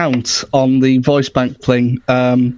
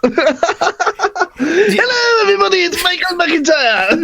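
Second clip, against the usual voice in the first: much higher, shouted comic-host voice; Michael McIntyre impression. Guest mimics comedian for radio host, high shouted stand-up voice. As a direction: belt it like a giddy stand-up host. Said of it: "an exact copy of Michael McIntyre".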